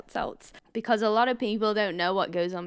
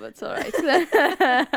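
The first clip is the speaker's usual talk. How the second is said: high, very slow through laughter; laugh-cry the words out; 7 semitones higher, 6 dB louder, much slower than usual. Real, not acted. Host laughing as she consoles her co-host.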